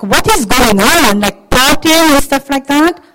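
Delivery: indignant, loud, stretched-out demand; angry older son. Acted. Older brother reacting to news of the party, loudly indignant. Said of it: "He was angry".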